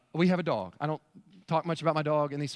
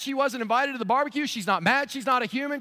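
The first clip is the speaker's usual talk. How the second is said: ordinary talk, nothing marked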